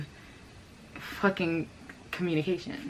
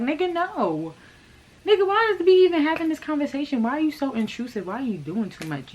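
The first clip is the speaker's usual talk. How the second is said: rip into him, indignant and fast; mock outraged rant; 6 semitones higher than usual. Her own angry retort retold.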